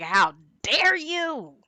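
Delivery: hammed-up indignation, voice lifted; mock affronted outrage. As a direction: imitate an offended old-timer, stretching it slowly. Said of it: "she's seeing it as an attack".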